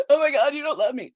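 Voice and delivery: a mock hysterical "you don't love me"; mock melodrama. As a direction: overplay the hurt partner's despair. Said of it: "nobody's like".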